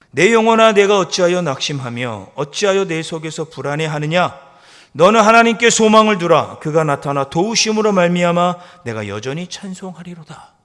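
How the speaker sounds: loud, high preaching of the verse; rebuking proclamation. Acted. Preacher crying the psalm at his soul, loud. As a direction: cry the psalm out at your own soul. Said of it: "이렇게 자기 영혼을 향해 꾸짖죠".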